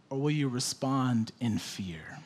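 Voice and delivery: whispered, hushed question. Acted. Preacher asks the flock about fear in a whisper.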